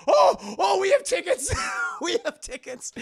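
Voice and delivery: high, loud panicked shout; frantic, waving tickets in fear; panicked crowd. Retold or imitated. Scared passengers shouting to Indy, high and loud.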